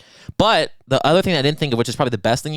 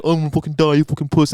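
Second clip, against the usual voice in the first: mock whiny; 3 semitones higher, 3 dB louder, faster than usual. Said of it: "how dumb and whiny I was".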